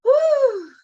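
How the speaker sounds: loud, high exhale of relief; big whew. Real, not acted. Mom asked about her son leaving, loud emotional whew. Guest asked how she's holding up.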